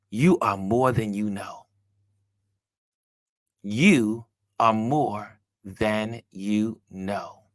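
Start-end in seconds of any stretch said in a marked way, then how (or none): 3.7-7.4 very slow, word by word; spaced-out, deliberate encouragement; slow emphasis. Real, not acted. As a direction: let each word land with a pause after it.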